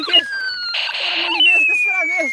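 very high squeaky voice, near falsetto; squeaky high voice, the last words whispered; squeaky put-on voice. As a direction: squeak it like a tiny cartoon creature. Gamer puts on squeaky voice.

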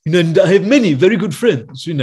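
Prince Charles impression. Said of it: "talk out the side of his mouth".

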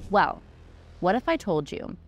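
ordinary talk

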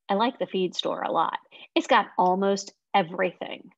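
plain reading voice

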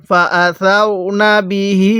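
measured chant with the last "hee" of বিহি held; slow Quran recitation. Shown as a demonstration. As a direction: recite slowly, stretching the end of বিহি. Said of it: "এক আলিফ টেনে পড়তে হবে".

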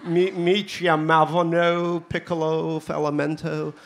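put-on slow, drawn-out Californian accent. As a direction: lay on a laid-back Californian accent. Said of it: "I'm trying to be Californian here".

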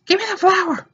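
high whiny "Give me the flower"; whiny demand. Acted. Gamer whining at the screen, high demand.

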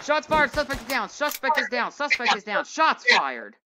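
high, urgent call-out; urgent radio report. Acted. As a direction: report it fast and keyed-up. Officer reports shooting over radio.

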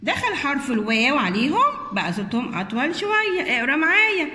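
ordinary talk, nothing marked

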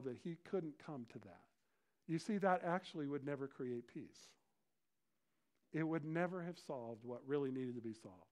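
usual speaking voice

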